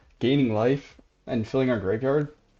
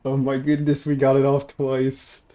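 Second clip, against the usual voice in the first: loud elated disbelief; thrilled; 3 semitones higher, 5 dB louder than usual. Real, not acted. Combo succeeds.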